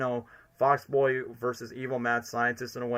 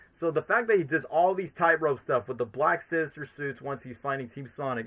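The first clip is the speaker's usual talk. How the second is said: ordinary talk, nothing marked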